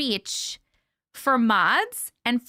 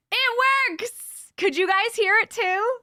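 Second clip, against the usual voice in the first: high-pitched, thrilled exclamation; elated, high and eager; excited delight; 9 semitones higher than usual. Real, not acted. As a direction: burst out delighted that it works. Delighted that text to speech works.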